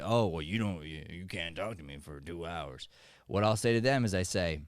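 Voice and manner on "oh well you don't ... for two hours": offended grandpa cowboy. Retold or imitated. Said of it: "when someone gets offended and they say".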